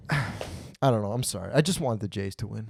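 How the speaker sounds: deflated. Real, not acted. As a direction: let it drop, resigned to the loss. Sad sigh after losing rant.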